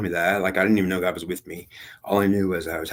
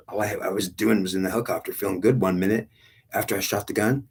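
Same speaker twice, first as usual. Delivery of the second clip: ordinary talk, nothing marked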